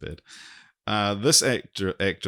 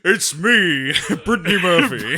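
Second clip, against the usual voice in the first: high, loud put-on voice through laughter; Brittany Murphy voice; 9 semitones higher, 7 dB louder than usual. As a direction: do her with a bright giggle. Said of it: "No, more like".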